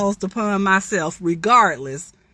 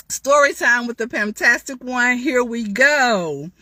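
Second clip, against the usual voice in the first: normal talking voice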